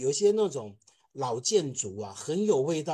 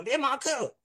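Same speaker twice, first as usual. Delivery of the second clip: much higher, exasperated scolding; scolding boss; 12 semitones higher than usual. Retold or imitated. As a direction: play a boss who can't believe the letter. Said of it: "他就骂我说".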